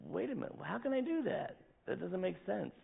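high, soft puzzled voice; baffled self-question. Retold or imitated. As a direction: ask yourself in disbelief. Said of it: "You know".

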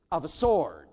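SWORD hit hard and loud; punched word. Shown as a demonstration. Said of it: "Say the word piercings".

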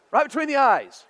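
ordinary talk

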